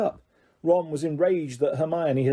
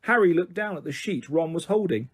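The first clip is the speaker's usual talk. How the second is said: ordinary delivery, nothing marked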